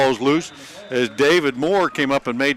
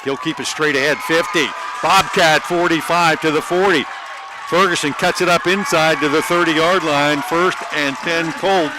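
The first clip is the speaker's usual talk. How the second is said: excited play call; 5 semitones higher, 5 dB louder than usual. Real, not acted. Big run called live.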